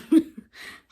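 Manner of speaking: loud high burst of laughter. Real, not acted. After joking with viewers, a loud high laugh. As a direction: crack up at your own joke. Laughing at her own threat.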